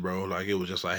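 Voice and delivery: normal talking voice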